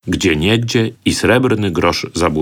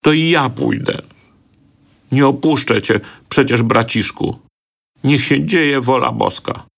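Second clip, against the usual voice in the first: high, tearful resignation; plaintive girl's voice, held well up; half crying; 6 semitones higher than usual. A narrator's reading. Sister refusing to abandon her brother, half crying. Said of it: "na wpół z płaczem zawołała Halszka".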